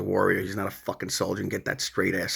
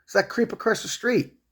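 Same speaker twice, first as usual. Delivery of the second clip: higher, indignant retelling of what he told the cops; retold complaint to cops; 10 semitones higher than usual. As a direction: pitch it up and sound fed up. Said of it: "I go".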